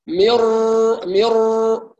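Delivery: مر with the nasal fading away too soon; ghunna fading. Shown as a demonstration. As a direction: show the lost ghunna in مر. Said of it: "ما فيش غنة، هو زال". (مر بغنة تزول في زمن الراء)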